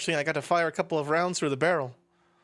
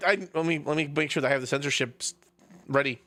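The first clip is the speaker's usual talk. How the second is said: normal talking voice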